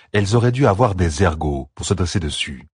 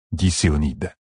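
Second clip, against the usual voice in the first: usual speaking voice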